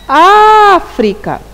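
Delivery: África with the first syllable stretched high and loud; stress on África. Shown as a demonstration. Teacher showing pupils the tonic syllable, first syllable of África stressed hard. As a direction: punch out and hold the Á in África. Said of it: "pronunciamos com maior intensidade".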